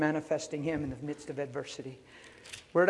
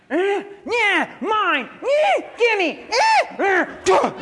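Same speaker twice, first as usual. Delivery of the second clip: put-on very high, loud toddler shrieks; squabbling toddlers; 11 dB louder, much slower than usual. Two-year-olds want same toy, mock tantrum squeals. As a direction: imitate a tot grabbing a toy.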